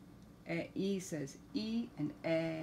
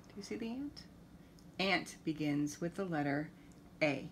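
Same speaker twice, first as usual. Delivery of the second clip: usual speaking voice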